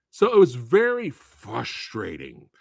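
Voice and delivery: normal talking voice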